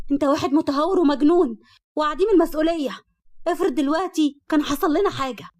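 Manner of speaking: loud, high-pitched scolding; sharp angry shout. A narrator's reading. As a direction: berate a reckless driver at full voice. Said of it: "وصرخت فيه بحدة".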